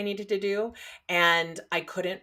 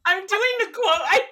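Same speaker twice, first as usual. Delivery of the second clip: high, loud squeal breaking into laughter; tickled, squealed admission; laughing protest; 12 semitones higher, 8 dB louder, faster than usual. Real, not acted. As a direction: protest helplessly, voice shooting up into a laugh. Teased about air quotes, bursts out laughing.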